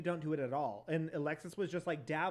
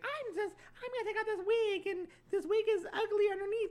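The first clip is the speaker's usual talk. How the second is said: much higher put-on voice, mocking her; high mocking impression; 3 dB louder than usual.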